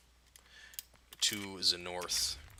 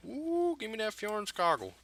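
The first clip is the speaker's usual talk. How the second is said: high slow squeal of delight; high, slow excited; 12 semitones higher than usual. Real, not acted. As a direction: stretch it out, thrilled. Excited at loot.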